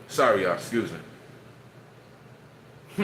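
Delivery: talking with food in mouth; mouth full. Real, not acted. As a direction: speak through a mouthful. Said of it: "I shouldn't be eating when I'm talking".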